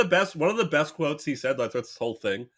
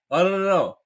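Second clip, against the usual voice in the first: raised, louder flustered denial; mock hasty denial; 5 semitones higher, 5 dB louder than usual. Senator recognised in Mexico by passers-by, mock hasty denial. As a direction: deny it loudly, as if caught out. Cruz caught in Mexico, denying.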